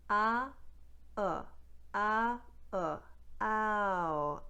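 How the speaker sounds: "ah" then "uh", built into "ow"; standard ow diphthong. Shown as a demonstration. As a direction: glide from ah to uh. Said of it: "the ow diphthong starts with ah".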